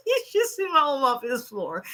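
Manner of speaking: laughing through the words; giggling while talking. Real, not acted. Laughing after a joke.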